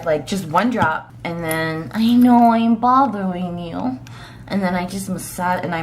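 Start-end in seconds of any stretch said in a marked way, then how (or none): 1.9-4.0 puppy voice. Acted. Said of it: "my puppy voice is annoying".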